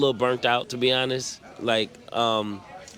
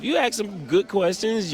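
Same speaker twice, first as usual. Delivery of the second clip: ordinary delivery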